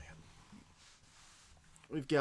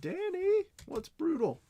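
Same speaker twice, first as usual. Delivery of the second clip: squeaky falsetto question; high squeaky voice; slower than usual. Pretend. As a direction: pitch it way up and squeak. Rereads chat question in put-on voice.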